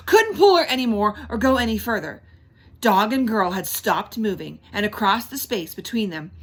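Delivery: ordinary delivery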